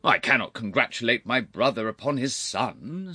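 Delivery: loud, high, irritable; touchy temper. A narrator's reading. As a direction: let the bad temper show. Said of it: "notoriously touchy in temper".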